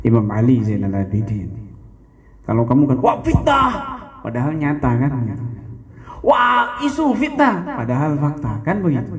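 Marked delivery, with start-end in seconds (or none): 3.0-3.7 high, put-on cry of outrage; mock indignant cry. Preacher mocking a listener who cries slander, high mock outrage. 6.3-7.6 high, slow shouted mock protest; mock outraged shout. Preacher mocking those who dismiss facts, slow high mock shout.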